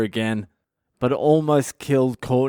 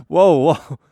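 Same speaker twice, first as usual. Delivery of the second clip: higher, loud alarmed "whoa, whoa"; loud, raised-pitch startled stop; 4 semitones higher, 9 dB louder than usual. Retold or imitated. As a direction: rear back loudly and cut her off. Stopping the mother's gush.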